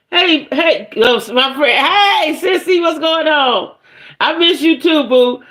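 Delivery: high, bright-voiced hello to a friend; excited greeting. Real, not acted. Greeting viewers joining the live.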